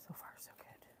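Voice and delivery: hushed concentrating whisper. Real, not acted. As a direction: whisper while you focus. Whispered while cutting pizza.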